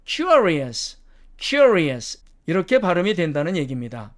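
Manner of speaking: "curious" with uh before r; British pronunciation. Shown as a demonstration. Teacher showing the changed vowel, British pronunciation. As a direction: say "curious" as "kyoo-uh-ree-us". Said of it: "어로 변합니다".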